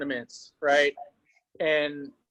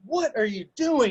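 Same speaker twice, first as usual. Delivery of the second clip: high incredulous cry; can't believe what he just saw; exasperated coach; 11 semitones higher than usual. Retold or imitated. Coach reacts to his wrestler getting pinned, high disbelief. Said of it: "I was like".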